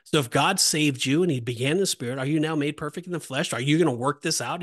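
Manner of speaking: normal talking voice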